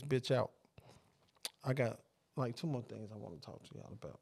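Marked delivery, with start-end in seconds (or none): 1.6-4.2 low hushed murmur into the mic; whispering. Real, not acted. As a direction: drop to a soft late-night murmur. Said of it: "Fuck you whispering for?".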